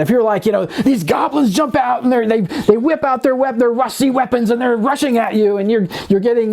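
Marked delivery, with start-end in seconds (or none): 0.7-5.6 higher, excited goblin-attack narration; energetic DM. Retold or imitated. DM hyping his players as goblins attack, excited and higher. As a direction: pump up the table with the charge. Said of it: "you're bringing this energy to it".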